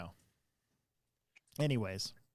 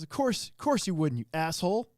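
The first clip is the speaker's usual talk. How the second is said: mock insult; 6 semitones higher, 4 dB louder than usual. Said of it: "he goes".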